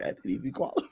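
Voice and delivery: imitated mumble. Imitating the student who failed to qualify, soft mumble. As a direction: mimic a dejected mumble under the breath. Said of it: "he mumbled".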